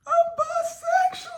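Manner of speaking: very high, cracking cry of a confession; yelled confession. Retold or imitated. Teen blurting a confession to her mom, high yelled cry. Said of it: "I just yelled out".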